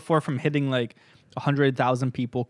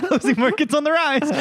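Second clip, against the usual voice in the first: higher, laughing mock pitch; 14 semitones higher, 4 dB louder than usual. Cohost repeats the ad slogan, laughing hard. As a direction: repeat the slogan while cracking up.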